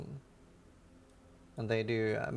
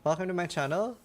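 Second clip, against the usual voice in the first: bright high voice; 6 semitones higher, 4 dB louder than usual. Pretend. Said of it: "I never had French in school".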